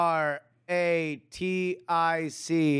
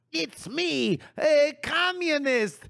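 cartoon villain voice; 11 semitones higher than usual.

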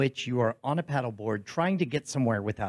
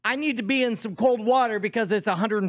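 emphatic declaration; 9 semitones higher than usual. Acted. Co-host explaining his friend's heat madness, high emphatic declaration. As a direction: declare it like a case for the defence. Mock-earnest defence on friend's behalf.

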